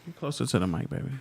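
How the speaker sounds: ordinary talk, nothing marked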